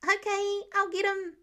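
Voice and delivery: put-on a boy rushing off on a task; eager little boy. Eager child answers.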